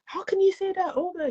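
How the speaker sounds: lightly voiced mentor objecting; mentor's protest. Mentor replies reassuringly.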